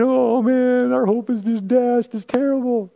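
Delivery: a touch of hammed-up gloom, pitch up; mock moping. Disciples moping on the road, mock-mournful whining. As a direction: whine it like a sulking teenager. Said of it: "they were walking around moping".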